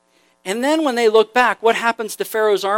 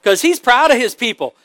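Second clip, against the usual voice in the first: ordinary talk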